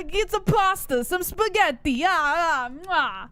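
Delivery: hammed-up Bronx caricature; Bronx accent. Co-host showing how they talk, mock Bronx accent. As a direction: play a food-loving Bronx local, accent laid on thick. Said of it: "That's how they talk".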